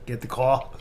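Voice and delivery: broad Boston vowel on car; mock Boston accent. Returning to the joke in mock Boston. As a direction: drop the r in Boston style. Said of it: "it sounded like Boston".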